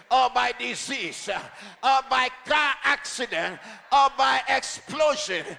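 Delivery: loud, driving, shouted declarations; preacher rousing the congregation, shouting; preaching at full force. Acted. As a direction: hammer each claim home like a warrior.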